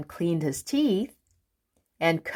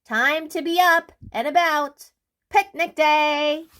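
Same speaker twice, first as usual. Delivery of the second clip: much higher, louder call; 9 semitones higher, 4 dB louder than usual. Acted. Mister Busy calls his friend, loudly. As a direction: wake the house. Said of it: "he cried".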